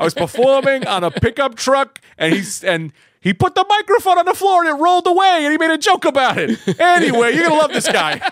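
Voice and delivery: put-on very high, loud caricature accent; hammed-up accent at full volume; [name] in put-on accent. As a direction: holler the tale in an absurd, high-pitched accent. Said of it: "He doesn't sound like that".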